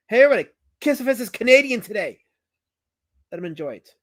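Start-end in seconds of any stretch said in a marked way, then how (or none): 0.1-2.2 high, louder call to everyone; playful broadcast to the chat; loud announcement. Real, not acted. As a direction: announce it to the room.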